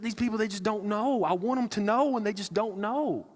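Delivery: yearning lament for people who don't understand; pleading heartbreak. Acted. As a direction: plead as if your heart is breaking. Voicing Jesus' heartbroken plea.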